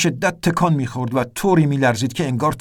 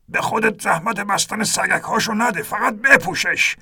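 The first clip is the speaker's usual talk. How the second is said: put-on old captain urging; 7 semitones higher than usual. A narrator's reading. Ernest telling the narrator to just put on the vest, urgent. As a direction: press it on him, no time to waste.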